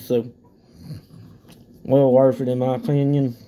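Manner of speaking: talking around tobacco wad; pausing to spit; mouth full of chew. Real, not acted. Seen 4: Lips pushed out with full cheek; he spits into a bottle repeatedly.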